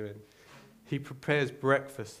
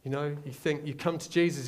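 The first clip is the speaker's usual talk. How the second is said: ordinary talk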